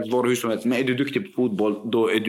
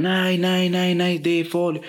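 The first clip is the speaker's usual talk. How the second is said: higher-pitched retold worried protest; mildly alarmed refusal; 5 semitones higher than usual. Teachers warning him off the locked door, mildly alarmed. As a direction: voice colleagues anxiously refusing, pitched up.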